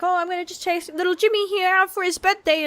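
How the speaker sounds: high, cheerful put-on parent voice; lightly voiced proud parent; mock cheery parent. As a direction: announce the birthday treat brightly.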